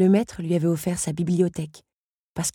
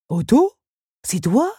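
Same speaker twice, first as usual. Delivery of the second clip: a sneering, higher question; contemptuous; 6 semitones higher, 5 dB louder, slower than usual. Acted. Henri scorns the fallen Otto, contemptuous. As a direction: look down on him. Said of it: "d'une voix méprisante".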